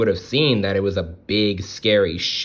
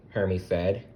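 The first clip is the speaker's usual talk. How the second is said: ordinary delivery, nothing marked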